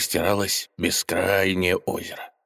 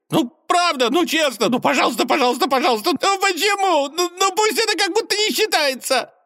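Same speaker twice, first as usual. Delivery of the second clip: high squeaky begging gopher; squeaky pleading; 3 dB louder than usual. Acted. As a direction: beg shrilly like a desperate rodent.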